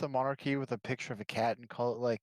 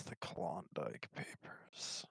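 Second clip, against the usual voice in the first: low, slow, breathless "the Klondike Papers" with a falling "Klondike"; ominous whisper; 10 semitones lower, 12 dB quieter, much slower than usual. Acted. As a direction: breathe it like a dark secret. Knowing echo of the topic.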